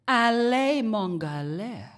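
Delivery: loud nonsense words, half foreign; mock made-up language. Sister playing along in fake language, loud mock gibberish. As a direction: sound out gibberish like real French. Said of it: "and she goes".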